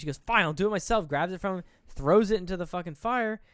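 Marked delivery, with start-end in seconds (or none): 0.2-1.1 louder, higher, fed-up outburst; bitterly angry. Retold or imitated. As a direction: imitate a wife snapping in bitter anger. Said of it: "bitterly angry".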